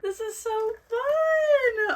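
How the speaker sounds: high, loud and drawn out, near tears; overjoyed squeal at a gift; squealing delight. Real, not acted. Delighted by the stickers.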